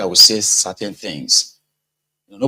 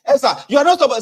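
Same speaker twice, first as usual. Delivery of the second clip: excited protest; 14 semitones higher, 4 dB louder, much faster than usual. Retold or imitated.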